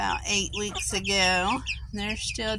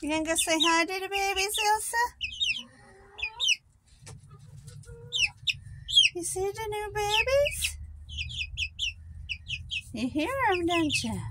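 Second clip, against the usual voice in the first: sweet high dog talk; pet talk; 10 semitones higher, 4 dB quieter, faster than usual. Real, not acted. Talking to dog Elsa.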